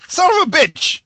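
sudden high yell of frustration; frustrated shout. Real, not acted. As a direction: blurt it out in a loud high curse. Frustrated at missing book.

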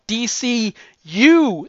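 U drawn out high and loud; slow down and punch the U; D C U with a big swooping U; stress on U. Shown as a demonstration. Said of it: "They're pointing at you".

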